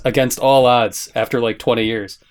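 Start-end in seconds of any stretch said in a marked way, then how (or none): none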